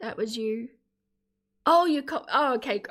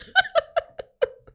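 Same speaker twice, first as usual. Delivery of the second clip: high laugh; 6 dB louder than usual. Real, not acted. Laughs about mouth full.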